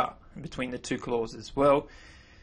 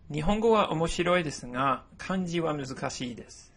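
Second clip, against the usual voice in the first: model sentence read; 4 semitones higher than usual. Shown as a demonstration. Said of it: "I'll give you an example".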